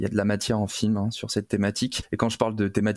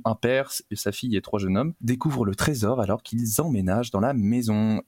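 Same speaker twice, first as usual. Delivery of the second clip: ordinary delivery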